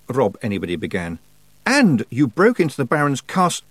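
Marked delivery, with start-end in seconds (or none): 1.7-3.6 raised, loud indignant voice; high, outraged scolding; angry accusation. A narrator's reading. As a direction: pile on the next charge. Tiffany angrily accuses Rob, cut off.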